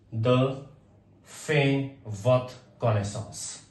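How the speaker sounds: fait votre connaissance drawn out word by word; very slow model phrase. Shown as a demonstration. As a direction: spell out fait votre connaissance slowly for learners. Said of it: "slow model phrase for learners". (बहुत धीरे, सिखाने के अंदाज़ में)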